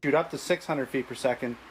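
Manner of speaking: normal talking voice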